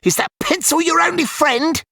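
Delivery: put-on high, sneering child voice; taunting bully. A narrator's reading. Petey mocking Ilian on the ground, high sneering taunt. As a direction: needle him like a playground bully.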